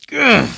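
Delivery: loud, high groan of exasperation; real burst of frustration; frustrated ugh. Real, not acted. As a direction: let out a sharp, angry ugh. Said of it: "So mad for doing that".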